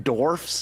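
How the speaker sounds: Dwarfs said "dorfs", with no w; mimicked mispronunciation. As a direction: drop the w from "Dwarfs". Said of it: "It's a silent W".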